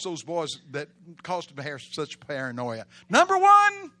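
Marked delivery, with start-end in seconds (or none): 3.1-3.9 high, loud announcement; shouted point number. Real, not acted. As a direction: belt out the heading. Preacher announces first point.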